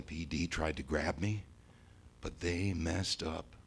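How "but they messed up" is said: ordinary delivery, nothing marked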